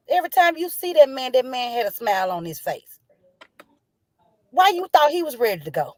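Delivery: grieving friend confronting an unseen killer, raw anger; furious questioning. Real, not acted. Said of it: "I'm truly angry".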